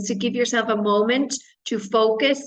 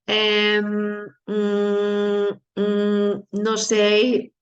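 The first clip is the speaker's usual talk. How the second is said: drawn-out, level hesitation noises; imitating an exam candidate gone blank; stuck for words; much slower than usual. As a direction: stall on long ums like a blanking student. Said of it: "than saying".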